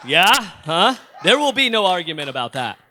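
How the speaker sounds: mock defiant shout. Speaker daring the audience to dispute his cereal choice, loud mock defiance. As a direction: belt it out like a challenge nobody may answer.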